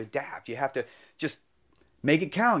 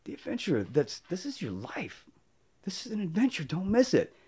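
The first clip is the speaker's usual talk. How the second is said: hushed earnest urging; 2 semitones higher, 6 dB quieter than usual. Retold or imitated. Telling his friend life is an adventure, in an earnest hush. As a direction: tell him gently but firmly.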